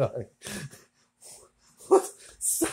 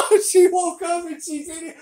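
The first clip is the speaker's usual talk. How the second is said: laughing; 12 semitones higher than usual. Real, not acted. Cracking up at sleeping girl.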